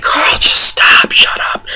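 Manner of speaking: slow, whispered plea to a character; exasperated whisper. Retold or imitated. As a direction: whisper it, fed up. Viewer's fed-up reaction to Carl.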